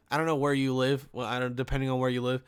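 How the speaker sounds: usual speaking voice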